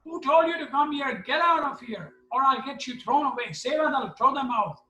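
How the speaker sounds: high-pitched indignant tirade; angry scolding. Retold or imitated. Big doctor throwing out intruders, mock angry tirade. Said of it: "We hear a big noise".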